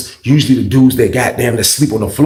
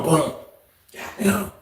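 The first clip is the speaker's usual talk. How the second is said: usual speaking voice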